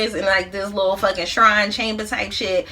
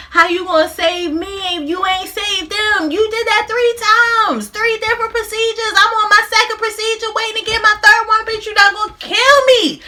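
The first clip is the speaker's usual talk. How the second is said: pitched way up, louder, half crying in disbelief; hammed-up outrage at the lying doctor; outraged high yell; 9 semitones higher, 3 dB louder than usual. Boy confronting the doctor who killed three patients, shrill outraged yell.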